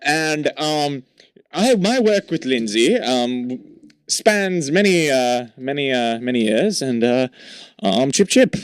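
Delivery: hammed-up British accent coach; put-on British accent. Correspondent [name] on coaching Lindsay Lohan, mock British accent. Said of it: "I was her accent coach for many years".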